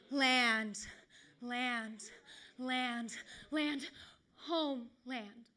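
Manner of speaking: drawn-out line, then repeats dying away; slow poetic echo; fading echo. Acted. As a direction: let each "land" echo and recede. Echoes the word, fading away.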